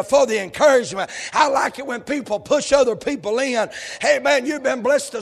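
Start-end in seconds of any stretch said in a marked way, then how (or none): none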